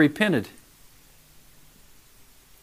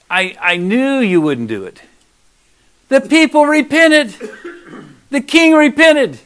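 ordinary talk

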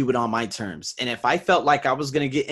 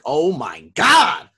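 much louder, slow drawn-out oh my God; awed gasp; 3 semitones higher, 10 dB louder, much slower than usual. Retold or imitated. Viewers react to his branding, loud slow amazement. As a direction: gasp it out in awe. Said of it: "you guys are probably like".

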